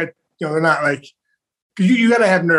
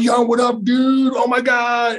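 high excited shout; nervous fighter shouting a hyped greeting at his friend; 7 semitones higher, much slower than usual. Retold or imitated. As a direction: yell it like you're bouncing off the walls. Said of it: "I'm going nuts".

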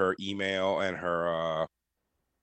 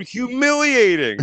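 loud, much higher, slow drawn-out dismay; mock horror; 10 dB louder, slower than usual. Real, not acted. Friend reacts to his embarrassing San Diego messages, loud mock horror. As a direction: howl it like it's unbearably embarrassing.

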